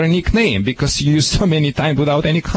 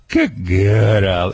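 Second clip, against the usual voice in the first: praise the puppy; 5 semitones lower than usual. Shown as a demonstration. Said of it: "From that time you say".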